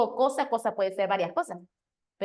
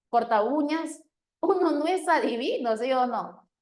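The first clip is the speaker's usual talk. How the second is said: ordinary delivery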